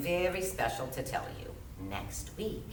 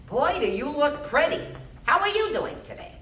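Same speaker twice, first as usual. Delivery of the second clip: put-on talking to a child; 9 semitones higher than usual. Social worker greets small child.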